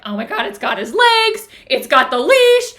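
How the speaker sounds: loud, high-pitched retold panic; freaking out. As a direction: cry out in alarm, re-enacting the scare. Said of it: "Like, I'm freaking out".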